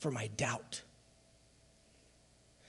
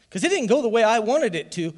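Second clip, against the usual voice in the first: sudden loud outburst; 8 semitones higher, 11 dB louder than usual. Real, not acted. Preacher's emotional outburst.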